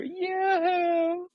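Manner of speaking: very high, drawn-out falsetto coo; imitated calling out. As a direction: mimic a woman hailing from a room. Imitates woman calling out to him.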